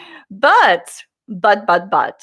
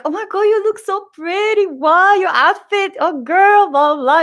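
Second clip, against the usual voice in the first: imitating the sweet seller, much higher; gushing friendly seller; 9 semitones higher, 3 dB louder than usual. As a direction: gush compliments like an excited fan. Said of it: "He was like".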